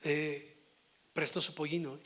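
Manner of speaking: normal talking voice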